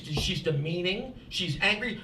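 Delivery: ordinary talk, nothing marked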